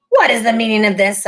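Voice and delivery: imperious witch queen; witch queen demanding. A narrator's reading. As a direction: demand an answer like a cold queen. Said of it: "asked the witch queen".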